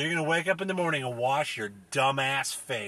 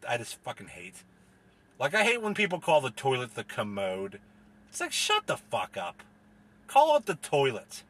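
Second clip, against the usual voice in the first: normal talking voice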